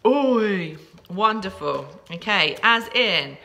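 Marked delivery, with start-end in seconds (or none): 0.1-0.8 put-on grumpy teen oi, pitch kept down; mock teenage grumpiness; grumpy teenager. Shown as a demonstration. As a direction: grunt it like a moody teenager. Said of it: "oi in a grumpy teenage voice".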